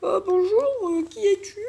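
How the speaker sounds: goofy father voice; parent voice. Acted. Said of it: "les darons, je vais faire des voix comme ça".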